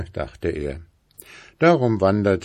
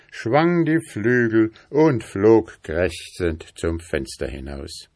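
plain reading voice

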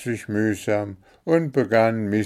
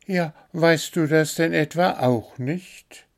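high, disbelieving question; little bird astonished at his friend; aghast; 8 semitones higher, 3 dB louder than usual. A narrator's reading. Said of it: "fragte der kleine Vogel fassungslos".

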